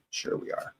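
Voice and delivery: whispered ironic aside; sarcastic whisper. Real, not acted. To the panel, undercutting her own point in a sarcastic whisper. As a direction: breathe it out as a dry jab.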